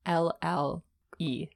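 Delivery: very slow, letter by letter; slow, deliberate spelling; spelling out slowly. Real, not acted. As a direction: draw out each letter as a guess. Guessing the weirdest spelling aloud.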